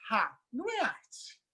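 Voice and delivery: a sneering little laugh, then brush it off; mock dismissive scoff. Imitating onlookers dismissing a fat performer's work, soft slow scoff. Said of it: "todo mundo olha e diz".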